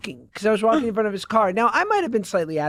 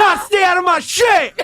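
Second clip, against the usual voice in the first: loud high laughing mock threat; mock tough threat; 10 semitones higher, 7 dB louder than usual. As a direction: bark it like a tough guy while laughing. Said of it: "I went to".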